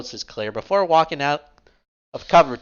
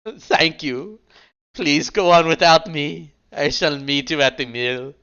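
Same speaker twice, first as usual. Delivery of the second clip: hammed-up tearful farewell, a bit higher; dramatic mock sorrow; 4 semitones higher, 3 dB louder, slower than usual. Rook bidding the others go on, mock tearful. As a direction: play the noble martyr, lightly sobbing. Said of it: "Rook dramatically sobs in sorrow".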